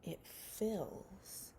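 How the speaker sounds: slow, hushed storybook reading with whispered lines; reading a picture book to children. Acted. Teacher reading a rain book to her class, hushed storybook voice. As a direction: read it gently to little ones, letting some lines fall to a whisper.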